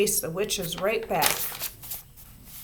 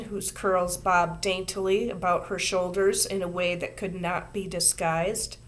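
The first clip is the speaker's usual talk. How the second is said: plain reading voice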